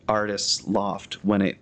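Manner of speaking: usual speaking voice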